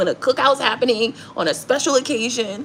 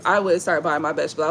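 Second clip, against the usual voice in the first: normal talking voice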